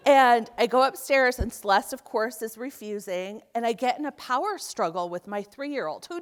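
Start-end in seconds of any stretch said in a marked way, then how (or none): none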